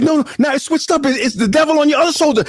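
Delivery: high-pitched, rushed mock alarm; imitating a spooked friend; frantic warning. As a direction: blurt it out high and fast.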